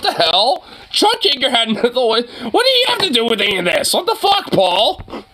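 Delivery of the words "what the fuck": usual speaking voice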